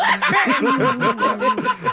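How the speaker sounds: laughing. Real, not acted. Laugh after singing.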